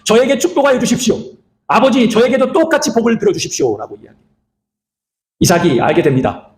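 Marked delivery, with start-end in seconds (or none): 0.0-3.8 imitated tearful cry. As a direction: play a son begging his father. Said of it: "에서는 울면서 소리치며". (울며 호소하는 흉내)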